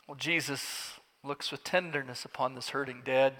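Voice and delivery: ordinary talk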